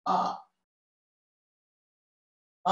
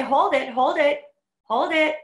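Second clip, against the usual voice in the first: loud, higher urging to hold; loud coaching; 5 semitones higher, 5 dB louder than usual. Real, not acted. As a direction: push them to hold on. Coach urging hold during exercise.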